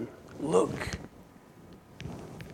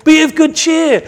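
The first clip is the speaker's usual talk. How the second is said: loud, high, urgent summons; calling out; 12 semitones higher, 11 dB louder than usual. Acted. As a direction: rouse someone with an excited shout. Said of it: "then they called the blind man, saying".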